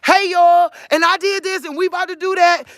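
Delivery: bright hollered hey y'all, quick at the end; a cheerful call to friends; my country way of talking. Shown as a demonstration. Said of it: "These people have accepted my".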